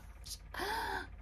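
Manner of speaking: sharp, voiceless gasp; mock gasp of shock. Reacting to being sent onward, shocked gasp. Acting out shock at good news.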